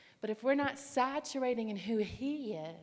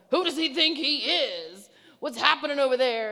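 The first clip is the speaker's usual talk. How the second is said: loud, higher-pitched mock outrage; indignant cub; 4 semitones higher, 9 dB louder than usual. One girl reacting to the passing man, mock indignant. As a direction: imitate a friend freaking out, loudly. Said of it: "you got one cub going".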